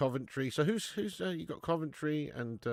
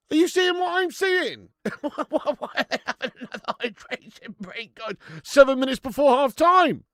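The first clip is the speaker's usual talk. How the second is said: loud, high, incredulous rant at the screen; outraged yelling; 14 semitones higher, 13 dB louder than usual. Real, not acted. Streamer ranting to chat about another hydration break, outraged shouting. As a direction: yell in disbelief at the break.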